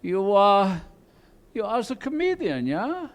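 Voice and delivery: lightly voiced stern German accent; mock humorless German cop accent; a hint of a German officer. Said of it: "It's like".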